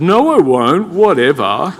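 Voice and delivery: mock teenage whatever; teen brush-off. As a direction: toss it off like a bratty teen. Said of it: "the testosterone answered".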